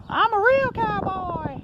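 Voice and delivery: high, slow cowboy toy voice; Woody voice. Retold or imitated. Vlogger voicing the Woody statue, high and slow. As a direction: squeak it out like a pull-string cowboy doll.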